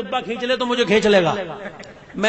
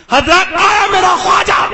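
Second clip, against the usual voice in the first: high, ringing sermon shout; impassioned high-pitched oratory; full-force preaching; 10 semitones higher, 8 dB louder than usual. Real, not acted. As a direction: thunder it out to a crowd.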